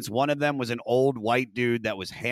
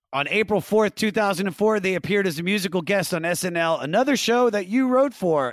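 higher-pitched host reading prepared notes; scripted read; 8 semitones higher, 4 dB louder than usual. Acted. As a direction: announce the next career milestone from a script. Reads show script aloud.

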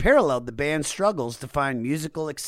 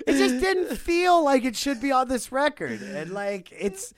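laughing; 12 semitones higher than usual. Real, not acted. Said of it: "laughing through own line".

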